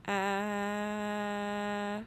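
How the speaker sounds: long open "Ah"; teacher holding "Ah" for the class; held sound. Shown as a demonstration. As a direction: hold "Ah" out steadily. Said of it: "say the sound".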